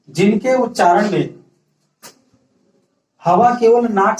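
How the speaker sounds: slow, even dictation pace for note-taking. Shown as a demonstration. Teacher dictating a definition to the class, slow and even. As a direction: spell it out slowly so students can write. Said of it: "right कर लीजिए".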